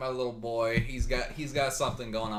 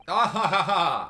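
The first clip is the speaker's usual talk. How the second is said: loud, slow, theatrical laugh; hammy hearty laugh; 6 semitones higher, 10 dB louder than usual. Acted. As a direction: laugh it off grandly as Sholmes. Sholmes laughs off his mistake.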